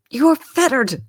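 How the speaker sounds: high, loud fearful cry; trembling. A narrator's reading. Scrooge trembles before the chained ghost. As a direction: shake with fear on it.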